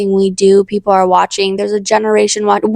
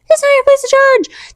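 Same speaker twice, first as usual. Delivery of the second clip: much higher, pleading insistence; impassioned repeat. Shown as a demonstration. Urging viewers passionately, much higher. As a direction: push it way up with feeling.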